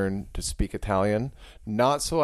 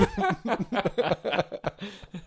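high laugh; 12 semitones higher than usual. Real, not acted. Laughing at own Aussie joke.

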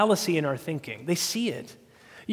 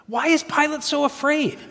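normal talking voice

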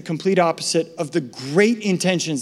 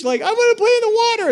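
put-on high, fast whiny kid; whiny child; faster than usual. Boy begging parents, high whining. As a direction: whine like a small child.

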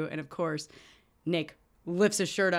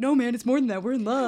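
mock protest; 6 semitones higher, 5 dB louder, faster than usual. Henry protesting in retold scene.

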